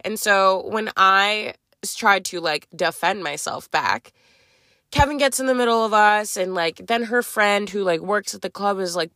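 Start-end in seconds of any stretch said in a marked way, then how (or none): none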